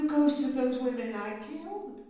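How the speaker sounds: loud, swelling declaration; rising outburst. Acted. Countess telling the servant about her victims' ghosts: loud outburst. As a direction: let it burst out of her.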